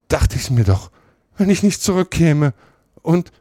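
höher, lauter, abbrechend; verstört. A narrator's reading. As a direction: stammle es voller Angst. Potter stammelt verstört. (Potter stammelt ängstlich und abbrechend)

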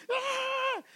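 high mock crying shriek; one long high fake wail; mock child's wail. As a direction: imitate a spoiled child's tantrum cry. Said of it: "Se pone a llorar".

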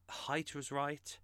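ordinary talk

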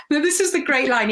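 ordinary talk

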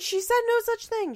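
high, loud indignant denial; emphatic denial. Real, not acted. Defending the nurse against commenters, emphatic loud denial. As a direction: push it up high and loud in protest.

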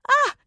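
sharp high shriek of fright; a sudden frightened cry; startled shriek; startled scream. A narrator's reading.

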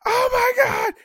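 very high-pitched mock shriek; imitated scream. Complainer mimics women in a restaurant, high mock scream. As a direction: mimic screaming at good news. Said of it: "they screamed. They were like".

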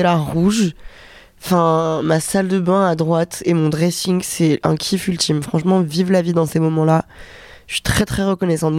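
ordinary delivery

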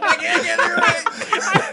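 high, fast, laughing mock panic; mock helpless confusion. Host mocking players stumped by beads, high laughing mock panic. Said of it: "You guys are going up to my bead door like".

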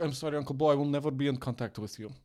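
blunt mock Russian accent; deadpan Russian refusal, hammed-up accent; Natalie. Imitating Russian fiancée refusing contact with uncle, blunt Russian accent. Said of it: "so straightforward 'cause she's Russian".

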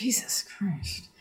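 whispered in exasperation; exasperated whisper. Real, not acted. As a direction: breathe it out, fed up. Exasperated under breath.

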